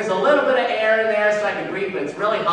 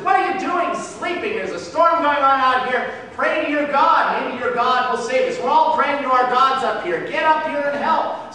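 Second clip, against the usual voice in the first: raised, urgent captain's voice; captain shouting; 6 semitones higher than usual. Acted. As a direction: bark it over the storm. Captain shouting to wake Jonah.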